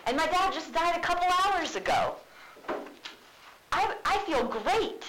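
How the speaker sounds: sarcastic Hamlet. Retold or imitated. Teacher paraphrasing Hamlet mocking his mother's happiness, sarcastic. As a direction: drip with mock cheer. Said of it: "So he's like".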